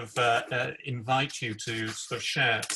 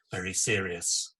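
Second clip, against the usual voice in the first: normal talking voice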